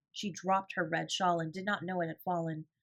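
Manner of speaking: plain reading voice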